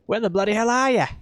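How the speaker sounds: high, mock Aussie ad voice in an Australian accent. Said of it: "tone down the yas".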